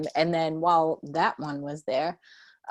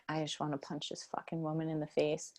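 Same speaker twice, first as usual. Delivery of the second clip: muttered inner thought; 2 semitones lower, 10 dB quieter than usual. Retold or imitated.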